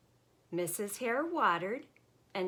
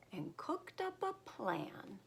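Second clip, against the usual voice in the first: whisper; 4 semitones higher, 7 dB quieter than usual. A narrator's reading. Conspiring story whisper.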